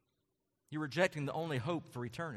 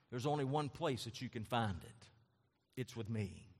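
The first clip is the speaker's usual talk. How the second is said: soft intimate; 5 semitones lower, 7 dB quieter than usual. Acted. Intimate voicing of Jesus' claim.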